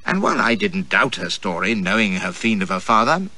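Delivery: ordinary delivery, nothing marked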